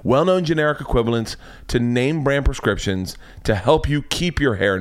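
ordinary delivery

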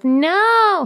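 put-on high, drawn-out whiny child's no; whiny son. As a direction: imitate a son's grating refusal. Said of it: "the worst sound in the world to me".

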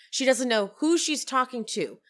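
usual speaking voice

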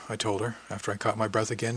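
plain reading voice

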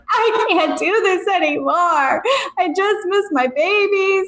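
high, sob-laden lament; retold crying. Mother telling her husband she misses her kids, high teary sob. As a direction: re-enact the teary breakdown, voice cracking. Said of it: "And I'm hysterically crying".

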